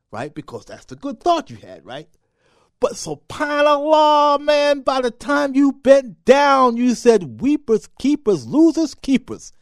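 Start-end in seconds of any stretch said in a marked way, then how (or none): 2.8-9.5 much higher and louder, slow and building; slow, rising preacher's cry; rising shout. Acted.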